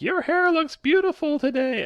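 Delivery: high, loud mock bullhorn shout; bullhorn compliment. Man booming a compliment at a woman through a bullhorn. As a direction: blare the compliment like through a megaphone. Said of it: "And he's got this bullhorn".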